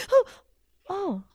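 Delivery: gasped high, loud "Oh!"; girl lost in the swamp, startled gasp. Acted. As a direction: jump at a sudden tap. Tap on shoulder in dark swamp.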